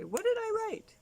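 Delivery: put-on high, eager child asking; little girl voice. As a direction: chirp it like a proud five-year-old. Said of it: "and say".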